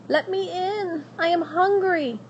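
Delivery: put-on high, pleading young princess. Princess begging at the witch's door, high and pleading.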